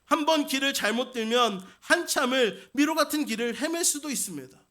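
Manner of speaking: normal talking voice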